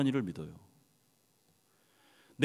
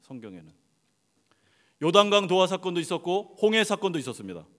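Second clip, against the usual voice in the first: ordinary talk, nothing marked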